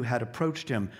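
ordinary talk, nothing marked

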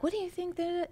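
imitating curious askers. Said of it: "they'll be like".

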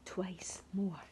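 soft whisper. A narrator's reading. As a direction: whisper it gently. Gentle bedtime kisses.

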